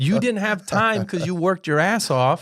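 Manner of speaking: high, loud exasperation; retold heated comeback; indignant correction. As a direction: hammer the point home at high pitch. Retold argument with his father.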